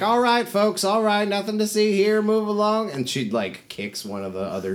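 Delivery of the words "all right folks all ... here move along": high, raised voice shooing onlookers along; crowd-clearing call. Acted. Trader waves off a crowd.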